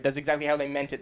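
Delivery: ordinary talk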